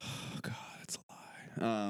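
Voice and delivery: sighing whisper, slow at the end; whispered groan. Real, not acted. Groaning at the cohost's joke, sighing whisper. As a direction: breathe a weary denial.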